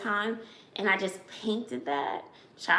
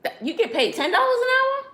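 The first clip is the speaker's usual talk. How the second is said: high, louder incredulous question; can't believe what she just heard; surprised; 5 dB louder than usual. Real, not acted. Mom surprised at daughter's pay.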